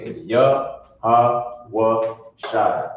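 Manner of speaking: very slow, "Yahweh Shai" drawn out and flowing; slow correct pronunciation. Shown as a demonstration. Teacher modelling the right reading. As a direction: stretch "Yahweh Shai" slowly and smoothly.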